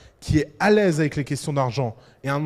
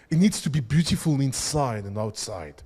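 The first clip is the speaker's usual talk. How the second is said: put-on British English accent; 5 semitones lower than usual. Speaker imitating Apple's British designer pitching the iPhone, British accent. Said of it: "avec son accent anglais".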